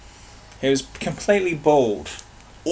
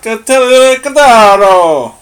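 higher, louder, slow fake Italian; mock Italian Latin; 7 semitones higher, 11 dB louder, slower than usual. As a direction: ham up an Italian orator, slowly. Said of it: "Italian Latin".

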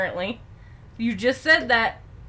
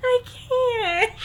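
laughing helplessly. Real, not acted. Overcome with laughter.

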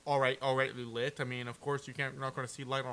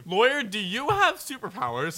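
mock surprise; 8 semitones higher, 8 dB louder, slower than usual. Defendant mocks the lawyer.

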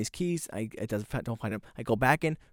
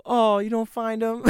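high, slow, cooing mock sympathy; imitated pitying onlookers; 11 semitones higher, 6 dB louder, slower than usual. People ask the defeated key-hunter, in high mock-pitying voice. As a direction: coo it high as a pitying stranger. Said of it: "people go".

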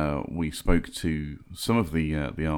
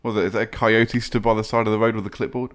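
ordinary talk

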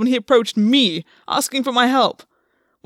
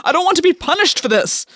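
higher, desperate outburst; shouted; 7 semitones higher than usual. Acted. Gwen losing control at August, desperate shout. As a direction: break out and shout it. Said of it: "Gwen shouted".

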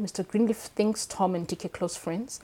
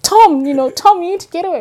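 much higher and louder, urging; urgent pleading; 11 semitones higher, 14 dB louder than usual. Retold or imitated. Reader urging Tom to flee, higher and louder. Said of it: "I was like".

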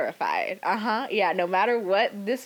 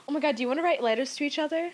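excited; 8 semitones higher, much faster than usual. Real, not acted. Excited by letter idea.

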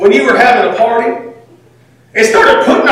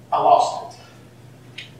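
hushed confession; 9 dB quieter, much slower than usual. Real, not acted. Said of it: "confession in sermon".

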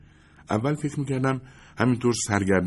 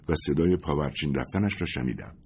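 usual speaking voice

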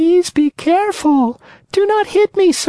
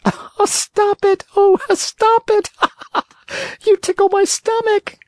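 giggling tiny voice. Acted. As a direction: squeal as if tickled. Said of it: "This time it giggled as it spoke".

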